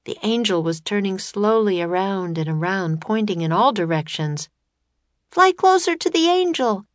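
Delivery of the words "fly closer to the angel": put-on high, loud girlish call over distance; shouting Annie. A narrator's reading. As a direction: shout it out, pitched way up. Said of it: "shouted Annie".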